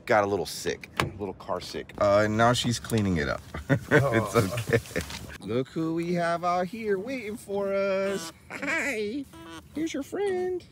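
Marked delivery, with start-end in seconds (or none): 5.4-10.6 high, soft sing-song voice for the animals. Real, not acted. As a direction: coo at the animals like they're babies. Greeting farm animals with puppy.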